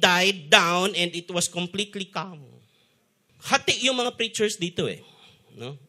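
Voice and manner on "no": usual speaking voice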